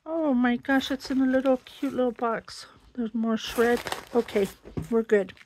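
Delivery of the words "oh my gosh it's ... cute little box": ordinary talk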